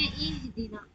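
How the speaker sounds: इहदिना begins with a clear ई, not ए; ई sound. Shown as a demonstration. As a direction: say इहदिना with a pure ई. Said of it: "इसे क्या पढ़ेंगे".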